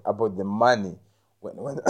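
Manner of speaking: ordinary talk, nothing marked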